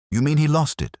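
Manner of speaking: high, incredulous rising query; surprised question. A narrator's reading. Sergeant questioning his inspector's hint, surprised.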